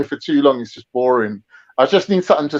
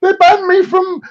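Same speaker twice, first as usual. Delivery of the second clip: much higher, slow, whiny withdrawal moan; mocking junkie voice; 12 semitones higher, 3 dB louder, slower than usual. Mocking a banned streamer viewer as an addict, high whiny moan. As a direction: play a kicked-out viewer as a desperate addict. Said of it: "like he's taking drugs away from you".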